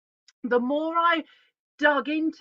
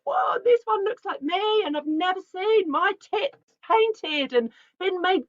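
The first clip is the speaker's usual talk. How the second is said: thrilled, much higher and a bit louder; high excited quoted gush; excited exclaiming; 8 semitones higher, 4 dB louder than usual. Retold or imitated. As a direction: gush like a delighted woman seeing herself painted. Women reacting to her paintings.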